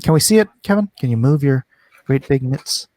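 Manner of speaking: usual speaking voice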